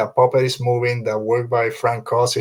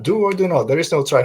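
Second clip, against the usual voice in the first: higher, run-together throwaway quote; glib recital; 4 semitones higher than usual. Shown as a demonstration. Fan just saying the quote, glib. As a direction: toss the quote off without any weight. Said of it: "You can just say the, the quote".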